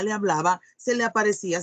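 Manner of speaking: ordinary delivery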